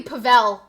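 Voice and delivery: Pavel as puh-VELL, stress on the second syllable; local pronunciation. Shown as a demonstration. Streamer explaining the local way, Pavel said puh-VELL. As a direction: say Pavel with a reduced first vowel, punching VELL. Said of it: "here it would be".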